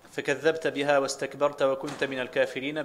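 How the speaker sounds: فكذبت، واستكبرت، وكنت each ending in "-ta"; fatha on the ta. Shown as a demonstration. Student reading a variant, fatha on every ta of فكذبت، واستكبرت، وكنت. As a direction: read "kadhdhabta", "istakbarta", "kunta" for فكذبت، واستكبرت، وكنت. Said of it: "بنصب التاء فيهن كلهن".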